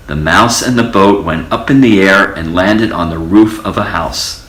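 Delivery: plain reading voice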